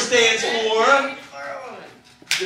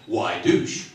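low, mock-serious ad voice; ad caption read-out; 12 semitones lower, 4 dB quieter than usual. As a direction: read it like an ominous ad slogan. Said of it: "It would flash on and say".

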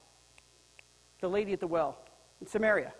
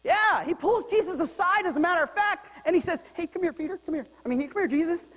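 acting out Peter; 8 semitones higher, 5 dB louder than usual. Acting out Peter pulling Jesus aside.

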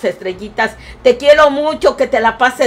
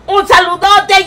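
excited shout; 8 semitones higher, 5 dB louder than usual. Real, not acted. Greeting a viewer loudly on stream.

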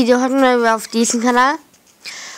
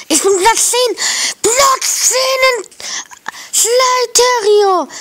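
excited shout; 12 semitones higher, 3 dB louder than usual. Real, not acted. Jumps to tenth place in game.

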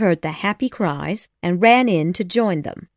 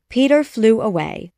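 ordinary delivery, nothing marked

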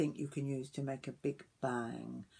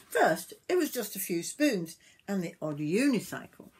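lively, sing-song storybook reading; story narration to children; 3 semitones higher, 7 dB louder than usual. A narrator's reading. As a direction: read it aloud to young children.

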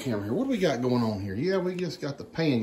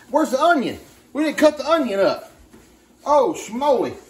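higher, louder flustered panic; alarmed realization; 8 semitones higher, 7 dB louder than usual. Real, not acted. Husband realises they forgot the onion, flustered higher and louder. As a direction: rush it, pitch up and louder, caught out.

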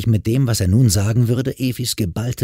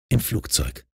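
usual speaking voice

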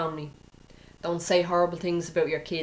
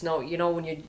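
ordinary talk, nothing marked

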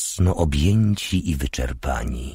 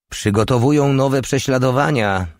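higher-pitched call into the room; 8 semitones higher, 4 dB louder than usual. A narrator's reading. Sister calls news into the room, calling out. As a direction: call it through to the next room. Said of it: "zawołała do pokoju".

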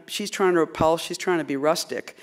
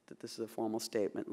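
normal talking voice

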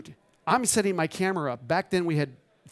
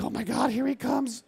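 higher-pitched mock panic; retold nervous dread; 6 semitones higher than usual. Photographer sees his feared client coming, nervous. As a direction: replay a nervous moment, pitch raised. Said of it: "I start shaking".

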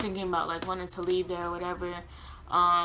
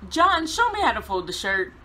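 insistent retort; 6 semitones higher, 6 dB louder than usual. Retold or imitated. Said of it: "And I'm like".

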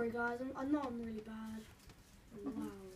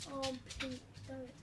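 ordinary talk

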